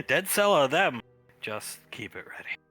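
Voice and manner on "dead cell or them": laughing. Real, not acted. Laughing joke.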